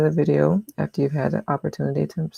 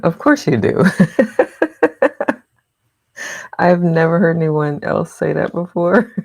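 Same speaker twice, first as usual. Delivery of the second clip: laughing loud and louder through the words; 3 semitones higher, 7 dB louder than usual. Real, not acted. Reacting to a friend's chat comment, laughing loud. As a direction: talk through a big laugh.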